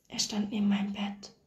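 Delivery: voiceless, awed whisper; hushed whisper. A narrator's reading. Dying woman telling the nurse of her husband's ghost, whispered. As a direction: whisper it like a secret from beyond.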